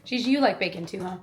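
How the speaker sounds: usual speaking voice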